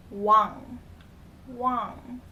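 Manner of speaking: wang sounded out twice, separately; wang with ng ending. Shown as a demonstration. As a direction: model the ng at the end of wang. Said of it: "pronounce it with the I N G".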